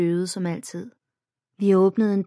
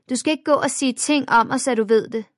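put-on threatening girl; 6 semitones higher, 4 dB louder than usual. A narrator's reading. Bully girl threatens narrator.

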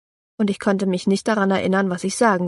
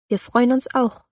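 nervous, higher stammer; tongue-tied girl answering the boy she likes; 5 semitones higher than usual. A narrator's reading. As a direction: stumble over the words, embarrassed. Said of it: "stammle ich wieder".

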